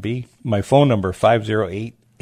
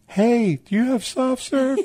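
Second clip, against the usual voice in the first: much higher, called across the water; calling out; 4 dB louder than usual. Retold or imitated. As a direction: hail the truck from a boat.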